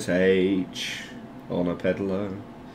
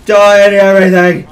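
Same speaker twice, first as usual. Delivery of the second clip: high, full-force gaming shout; battle yell; 10 semitones higher, 11 dB louder than usual. Real, not acted. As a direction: bellow it at the enemy. Yelling at opponents in game.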